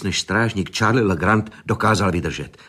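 ordinary delivery, nothing marked

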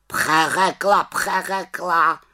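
high mocking raven echo; raven croak. A narrator's reading. Raven parroting the witch's excuse, mocking croak. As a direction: croak the word back like a scornful bird. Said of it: "krákal havran".

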